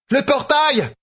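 very high, urgent call; urgent cry. A narrator's reading. Fail demanding a portal amid chaos, crying out. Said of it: "cria-t-elle".

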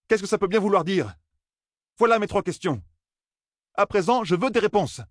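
put-on frustrated young man. A narrator's reading. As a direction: push the questions like an impatient youth.

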